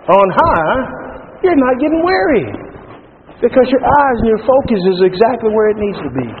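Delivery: high, exultant preaching delivery; fervent preaching. Acted. Preacher promising renewed strength to the weary, fervent. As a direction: lift the promise up to the congregation.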